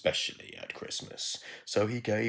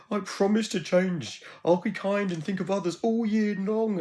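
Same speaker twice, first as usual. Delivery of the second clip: much higher, louder desperate pleading; begging; 7 dB louder than usual. Acted. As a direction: beg desperately. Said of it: "Ebenana begged".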